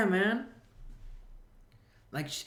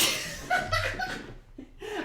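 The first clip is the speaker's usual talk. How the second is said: breathless squealing laugh; high wheezing laugh; 4 dB quieter than usual. Real, not acted. Comedian losing it at his own punchline, high wheezing laugh. Cracking up.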